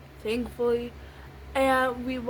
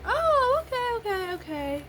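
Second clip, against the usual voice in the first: mock reaction; 11 semitones higher than usual. Others reacting to the injury news, mock high-pitched. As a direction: imitate them with an exaggerated high coo.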